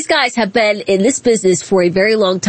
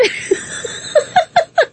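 high, airy burst of laughter; breathy giggle after the joke. Real, not acted. Laughing at own imitation.

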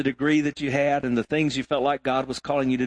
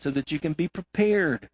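ordinary talk, nothing marked

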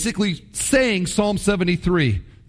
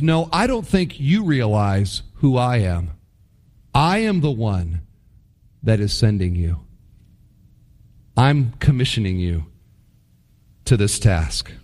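God answering; 8 semitones lower than usual. Retold or imitated. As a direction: answer with calm divine authority. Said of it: "what God is saying is".

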